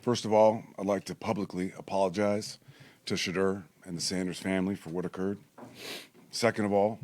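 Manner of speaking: measured, earnest public apology read from notes; formal press conference apology read aloud; formal statement. Real, not acted. Reads prepared apology statement.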